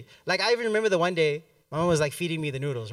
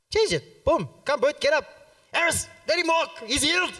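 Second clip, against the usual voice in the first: hyped-up command; 8 semitones higher than usual. Retold or imitated. Reenacting pastor's command.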